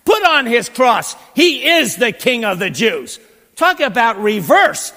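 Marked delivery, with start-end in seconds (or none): 0.0-3.2 Pilate's proclamation. Retold or imitated. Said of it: "Pilate goes".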